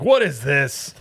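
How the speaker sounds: high, loud mock outrage; hammed-up aggressive shout; yelling chef. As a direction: yell it like an angry chef walking in. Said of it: "Starts yelling at".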